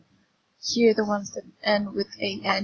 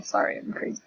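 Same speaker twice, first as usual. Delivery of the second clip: ordinary talk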